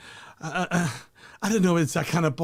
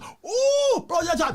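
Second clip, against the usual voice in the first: very high squealing ooh; mock mom squeal; 10 semitones higher, much slower than usual. Son imitating his mother selling shots, high squeal. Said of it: "you just".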